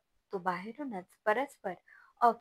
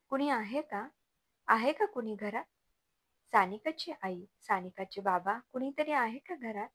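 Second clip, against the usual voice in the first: ordinary delivery, nothing marked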